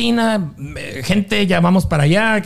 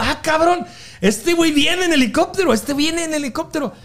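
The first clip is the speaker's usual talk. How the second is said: crowd excitement; 7 semitones higher than usual. Retold or imitated. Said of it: "todo el mundo".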